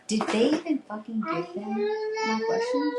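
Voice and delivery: pitched far up, rushing and incredulous; indignant disbelief. Retold or imitated. Heroine in disbelief at her handlers, high-pitched indignation. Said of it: "she was like".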